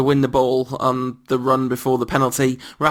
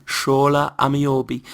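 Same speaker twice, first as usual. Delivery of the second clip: Newcastle accent. Shown as a demonstration. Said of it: "That's how you get into a Newcastle accent".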